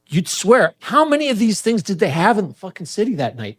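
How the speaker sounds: ordinary delivery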